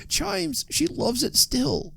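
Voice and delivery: very high, soft, anguished plea; agonized entreaty. A narrator's reading. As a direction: plead with the spirits, high and drawn out. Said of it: "he exclaimed in agonized entreaty for her".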